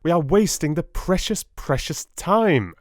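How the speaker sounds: high, urgent cry; cried out. A narrator's reading. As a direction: call out, pressing them to go. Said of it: "I cried".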